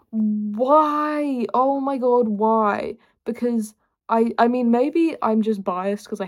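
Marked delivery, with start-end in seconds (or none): 0.1-3.0 theatrical exasperation, pitched up; exasperated. Acted. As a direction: plead in hammed-up disbelief. Exasperated rant about pointless ice.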